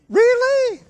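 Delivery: high, loud thrilled squeal; imitated excitement. Wife answering news of her daughter's arrival, mock excited squeal. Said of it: "She was excited".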